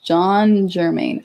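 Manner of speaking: ordinary delivery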